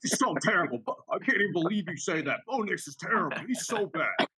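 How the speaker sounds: high-pitched mocking imitation of a friend; Brian impression. Teasing co-host by quoting his old opinion, high mocking impression. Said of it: "and Brian said".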